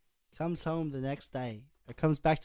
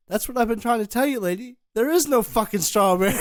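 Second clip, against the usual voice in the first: loud, higher outburst breaking into laughter; exasperated clerk; 7 semitones higher, 10 dB louder than usual. Acted. Clerk in the joke finally makes the lady get it, mock exasperated shout. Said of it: "He goes".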